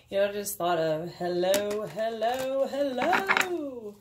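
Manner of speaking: rising, stretched theatrical hellos; Jim Carrey impression. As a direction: ham it up like Count Olaf greeting. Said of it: "Jim Carrey from, um, A Series of Unfortunate Events".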